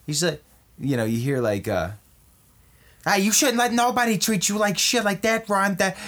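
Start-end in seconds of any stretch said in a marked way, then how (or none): 3.1-5.9 high, chatty gossip; gossipy woman. Acted. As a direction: dish advice like a nosy friend. High heel women talking.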